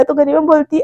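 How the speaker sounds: usual speaking voice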